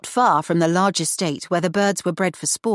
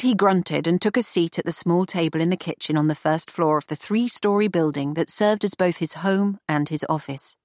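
ordinary delivery

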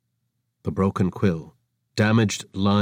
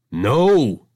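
flat refusal; 4 semitones higher, 6 dB louder than usual. Acted.

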